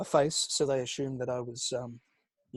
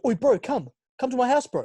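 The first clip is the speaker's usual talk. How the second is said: high, lively put-on lad voice; mock street lad; 9 semitones higher, 4 dB louder than usual. Kid inviting a stranger over, high mock-lad urging. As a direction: imitate a pushy kid, pitched up. Acting a kid's online invitation.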